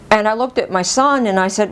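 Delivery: usual speaking voice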